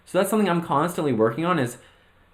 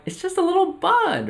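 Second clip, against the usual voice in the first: much higher, small squeaky voice; defensive little voice. Retold or imitated. Novice gardener answering nosy people in a squeaky high voice. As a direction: squeak it out like a timid beginner. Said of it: "And you're like".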